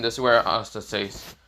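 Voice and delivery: plain reading voice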